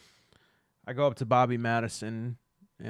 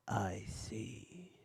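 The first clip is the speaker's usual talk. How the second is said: whisper; 9 dB quieter than usual. Acted. NPC reacts secretively.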